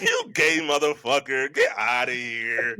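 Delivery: drawn-out, dismissive character jeer; slow mock-contemptuous send-off; Riley Freeman. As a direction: stretch out the cartoon taunt as you wave them off. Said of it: "I'm Riley Freeman on that one".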